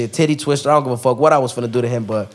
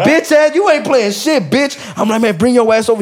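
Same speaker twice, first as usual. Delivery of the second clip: much higher, louder angry yell; angry retort; 10 semitones higher, 4 dB louder than usual. Retold or imitated. Squaring up to the guy with the bottle, angry yell. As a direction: bark back at the coward.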